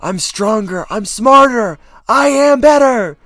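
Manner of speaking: hammed-up high, booming boast; pretend egomaniac. Actor doing a fun fake-ego bit, pretend egomaniac shouting. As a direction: shout it like a mock megalomaniac. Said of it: "I do pretend to have an ego".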